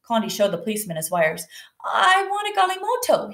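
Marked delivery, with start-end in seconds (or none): 1.8-3.3 put-on high, insistent boy's plea; emphatic storybook exclamation; exclaiming. A narrator's reading. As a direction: burst out with it, pitched way up. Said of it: "he exclaimed".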